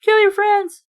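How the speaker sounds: loud angry cry, hammed up. A narrator's reading. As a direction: lash out in fury. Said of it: "I cried angrily".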